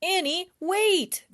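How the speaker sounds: a brother calling his sister to wait, loud and high. Acted. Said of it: "Jack called".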